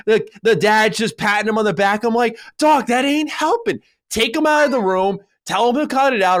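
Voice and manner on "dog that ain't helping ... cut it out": higher-pitched mock rebuke to a dad; acted exasperation. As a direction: act out fed-up advice, pitched up. Imagined rebuke to a passive dad.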